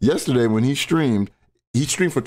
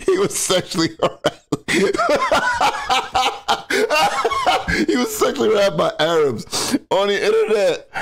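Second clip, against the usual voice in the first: high, laughing through the words; real helpless laughter; 10 semitones higher than usual. Real, not acted. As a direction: barely get it out for laughing. Repeating clip, laughing.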